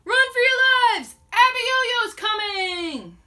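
very loud, high alarm call; panicked shout. Retold or imitated. Villagers fleeing Abiyoyo, panicked shout. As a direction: warn the whole town to flee.

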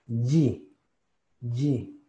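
"Y" as "jee", with a pure i vowel; pure Latin I. Shown as a demonstration. Teacher adding the vowel, "Y" sounded as "jee". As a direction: end "Y" on a clean "ee". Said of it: "cien por ciento I".

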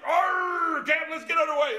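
high, loud pirate-like cheer to the captain; hammed-up sailor's yell, raring to go; eager sailor shout. Sailor shouting high and loud at the captain to sail.

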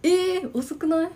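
high, slow, drawn-out surprise; surprised. Real, not acted. Surprised reply to a viewer who waited to use AC. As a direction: gasp it out in disbelief. Surprised at late air conditioning.

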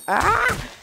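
short shriek, very high and loud; a real jump-scare yelp; startled high scream. Real, not acted. Sudden game shock.

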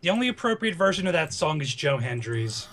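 ordinary talk, nothing marked

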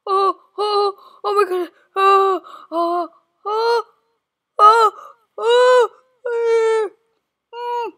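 panicked high yelps of fright; real fear, crying out; terrified cries. Real, not acted. Jumpscare in horror game.

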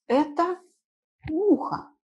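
ordinary talk, nothing marked

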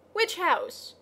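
high, sharp question with mild anger; angry. A narrator's reading. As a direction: demand it with a touch of anger. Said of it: "said Leo angrily".

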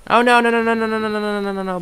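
rapid frantic no's that wind down; panicking as the monster attacks; panicked no's. Real, not acted.

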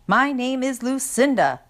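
high, pointed icy correction. A narrator's reading. Lucinda corrects her brother's nickname for her, icily. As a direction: correct the nickname with cold offence. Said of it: "she answered icily".

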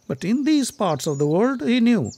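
ordinary delivery

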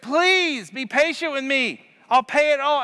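high pleading plea; lightly voiced desperate servant; begging servant. A narrator's reading. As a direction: beg the master for patience. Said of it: "He begged".

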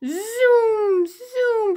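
high zooming car noise. Shown as a demonstration. Teacher zooms like a car for the kids.

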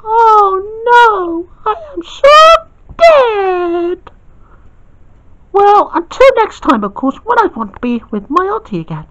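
high and mock-doomed, then cheery; high girl voice. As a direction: sign off to everyone, high. Girl figure signs off.

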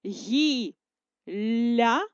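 гілля stretched into syllables; slow syllable dictation. Shown as a demonstration. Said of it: "Ділимо для переносу".

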